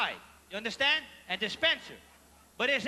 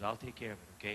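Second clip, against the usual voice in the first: quiet reassurance; 11 dB quieter, faster than usual. Retold or imitated. Cashier quietly reassures him.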